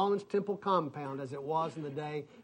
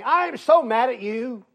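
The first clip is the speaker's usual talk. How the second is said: loud, higher imitation of anger; angry caller; 5 semitones higher, 8 dB louder than usual. Caller confronting his pastor by phone, acted angry outburst. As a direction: burst out as the furious caller, acted.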